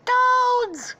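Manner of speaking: loud falsetto shout; princess summoning guards. Acted. Princess calling her guards, loud falsetto yell. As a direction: call out sharply, very high. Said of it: "and she's like".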